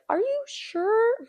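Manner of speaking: slow, high, patronizing question; drawn-out condescending doubt; condescending doctor. Retold or imitated. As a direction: talk down to her like she's a fool. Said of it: "talking to me like I'm stupid".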